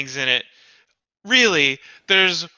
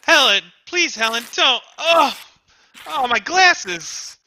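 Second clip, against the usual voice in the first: desperate pleading; 6 semitones higher than usual. Acted. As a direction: plead with her to stop, near panic. Husband begs as wife defaces book.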